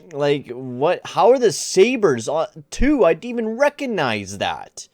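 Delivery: high, loud, drawn-out how are the Sabres; can't believe the standings, voice shooting up; stunned disbelief. Real, not acted. Shocked at the broken standings.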